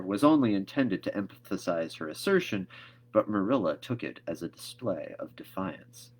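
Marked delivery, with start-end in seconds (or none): none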